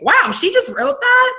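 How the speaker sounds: high, amazed gasp of a follower; squeaky mock wow; mock amazement. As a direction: gush like an impressed reader.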